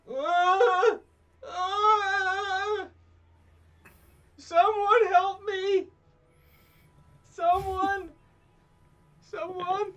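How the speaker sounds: high panicked screams for help; terrified horror victim. Acted. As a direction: shriek for help, pinned and desperate. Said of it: "and he's like".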